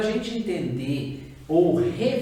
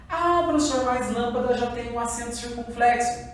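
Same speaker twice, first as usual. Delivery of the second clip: higher-pitched student piping up to the teacher; objecting pupil; 6 semitones higher than usual. Acted. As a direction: raise the voice into a pupil's protest.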